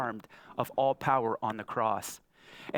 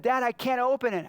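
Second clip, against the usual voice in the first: put-on high, louder whiny child voice; frustrated little girl; 10 semitones higher, 4 dB louder than usual. Daughter frustrated with the Barbie box, high and whiny. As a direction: whine like a frustrated six-year-old. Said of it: "She started getting frustrated".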